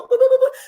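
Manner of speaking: high-pitched boop boop of dialing; phone dial beeps. Retold or imitated. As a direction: mimic the phone keypad, high and bright.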